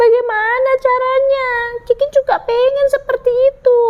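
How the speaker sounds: put-on high, pleading child voice; little chick. Ciki asks father how to crow, high plea.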